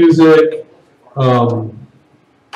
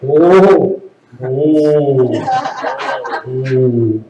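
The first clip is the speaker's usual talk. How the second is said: drawn-out calming disapproval; shutting it down; 6 semitones lower, much slower than usual. Shown as a demonstration. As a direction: wind it down oh by oh. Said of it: "when you have to shut it down".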